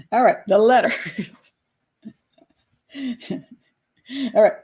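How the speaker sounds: ordinary talk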